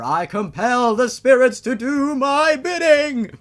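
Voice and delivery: high, loud cry of command to the spirits; commanding shout. Acted. Father forcing the spirits to obey, booming command. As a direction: bellow it as the spell peaks. Climax of the resurrection spell.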